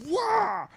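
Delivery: put-on high, loud babyish "wah"; mock whiny wail. Telling his friend how he whined at her, a high mock wail. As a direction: whine like a frustrated kid.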